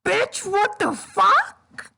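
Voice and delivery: high-pitched, drawn-out outraged yell; shocked yell. Real, not acted. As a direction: yell it high in disbelief, rising at the end. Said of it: "I yelled that part out".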